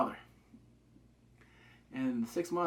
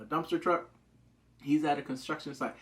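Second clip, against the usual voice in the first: ordinary delivery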